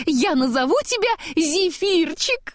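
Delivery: high, lightly voiced happy snowman; cheerful Olaf. A narrator's reading. Olaf naming the giant, high and cheerful. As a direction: christen him with delight.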